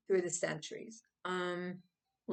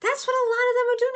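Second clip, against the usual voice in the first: excited outburst; 9 dB louder, faster than usual. Real, not acted. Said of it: "excited high burst".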